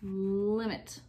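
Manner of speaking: drawn-out first sound on limit; blended syllables. Shown as a demonstration. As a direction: hold the L, then run "limit" together. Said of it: "All together".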